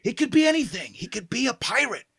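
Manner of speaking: high, projected stage whisper; be a pirate who only whispers. Acted. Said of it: "I'm the whispering pirate".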